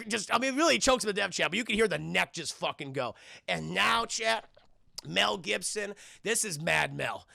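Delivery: ordinary talk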